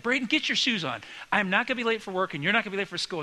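retold parental telling-off; ornery, hurried parent. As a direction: act out an impatient morning scolding. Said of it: "in a hurry and ornery".

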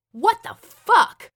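high, loud, drawn-out shout; yell. A narrator's reading. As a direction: yell it slowly in disbelief. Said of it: "I yelled as I launched".

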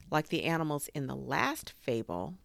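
ordinary delivery

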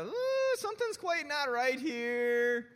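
high, loud suspicious dad. Shown as a demonstration. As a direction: raise an eyebrow and say it slowly. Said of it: "That's the".

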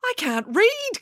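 high little chicken voice; high-pitched storybook admission; confessing chicken. A narrator's reading. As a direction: own up in a small, high voice. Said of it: "confessed Chicken Little".

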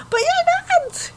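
mock high protest. Josie telling Griselda she is not fat, high mock protest. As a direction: squeak it out, drawn long.

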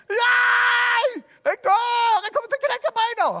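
put-on whimper-scream like a terrified kid; terrified child refusal. Boy refusing to jump from the roof, panicked high wail.

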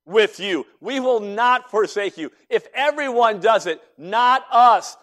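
loud, high, drawn-out pledge of loyalty; disciples' emphatic vow. Retold or imitated. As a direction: swear it forcefully, stretching the key words. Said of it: "in one voice, they said".

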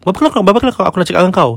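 high, sharp scolding tirade; angry scolding. Retold or imitated. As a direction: scold her for the bin, fast and fed up. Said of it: "she was screaming lah".

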